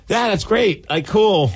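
high, fake-enthusiastic brush-off; sarcastic. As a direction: feign interest, clearly bored. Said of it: "I'm just like".

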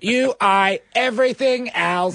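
stiff robot, rising high on else. Pretend. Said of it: "you have to do a robot voice".

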